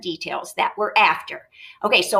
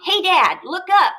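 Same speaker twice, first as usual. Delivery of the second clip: put-on higher, louder kid voice; boy teasing his dad, cheeky and higher; cheeky child; 9 semitones higher, 4 dB louder than usual. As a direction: tease your dad. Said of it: "Lori said".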